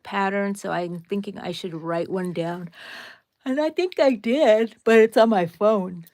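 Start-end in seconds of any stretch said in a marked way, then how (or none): 3.4-6.1 talking through a real yawn; words stretched by a yawn. Real, not acted. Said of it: "Sorry for the yawning".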